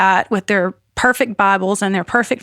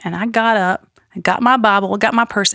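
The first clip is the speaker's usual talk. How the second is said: ordinary delivery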